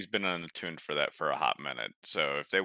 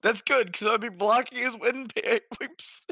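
high joking; 13 semitones higher, 3 dB louder than usual. Real, not acted. Joking loudly at the innuendo.

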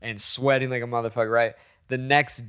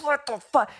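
high, strained agonized voice; re-enacted agony; pained exclamation; 14 semitones higher than usual. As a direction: squeeze it out high through the pain. Reliving pain lying on driveway.

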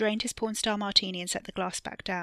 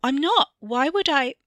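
high, loud, rattled denial; alarmed protest; 7 semitones higher, 10 dB louder than usual. A narrator's reading. Theo is shaken by Wesley and protests, alarmed. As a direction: protest in a raised, frightened voice.

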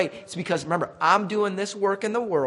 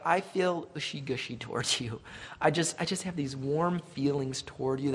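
mock gushy sentiment; 3 semitones lower, 7 dB quieter than usual. Mocks sentimental idea of love.

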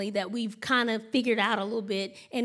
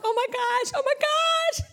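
high-pitched, climbing mock exasperation; a frazzled parent at wit's end; exasperated mom; 13 semitones higher than usual. Exasperated mother reacting to nagging.

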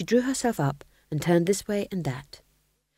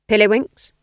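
louder, higher eager guess; ghost king guessing; 4 semitones higher, 8 dB louder than usual. A narrator's reading. Ghost king guessing at Nanny's game, loud and eager. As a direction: blurt out a hopeful guess. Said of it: "he suggested".

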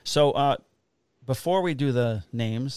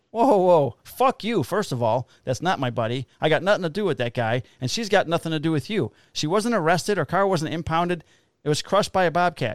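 higher, louder angry retort; retold yelling match; 5 semitones higher, 3 dB louder than usual. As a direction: shout him down in a rage. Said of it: "we get in this huge yelling match".

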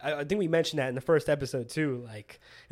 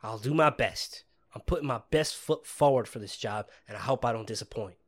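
country twang; 6 semitones lower than usual. Acted. Rookie promising to do his best, low country twang. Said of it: "bust out my country twang".

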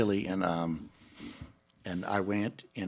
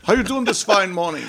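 imitating Paul; 10 semitones higher, 8 dB louder than usual. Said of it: "that's what the first, first thing he said to me".

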